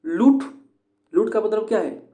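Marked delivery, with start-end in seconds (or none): 0.1-0.4 लूट said much higher and louder; stressed लूट. Shown as a demonstration. Teacher contrasting लूट with a stressed syllable. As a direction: hit लूट hard and high. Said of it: "इसमें अलग है".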